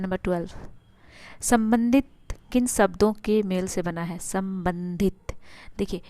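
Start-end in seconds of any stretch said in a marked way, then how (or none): 4.2-5.3 slow demo. Shown as a demonstration. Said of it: "ध्यान से अगर उच्चारण कीजिएगा आप".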